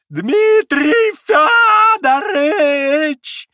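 high, drawn-out shrieking call; wild howl. A narrator's reading. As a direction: howl the name in a voice not his own. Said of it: "завопил вдруг каким-то не своим голосом".

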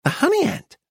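high-pitched, full-voiced dealer; announcing shopkeeper. Dealer announces it brightly to customers.

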